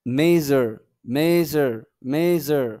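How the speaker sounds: "মেজার" loud, with a blended zh in the middle; pronunciation drill. Shown as a demonstration. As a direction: model "মেজার" firmly, separated by pauses. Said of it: "তারপরে দেখেন".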